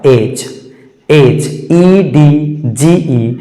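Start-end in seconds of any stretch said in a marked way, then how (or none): 1.1-1.5 एज said as "ej", no d sound; correct pronunciation. Shown as a demonstration. Teacher to class on silent d, slow एज. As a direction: stretch एज slowly as "ej", d silent. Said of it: "इसका सही उच्चारण हो जाएगा एज".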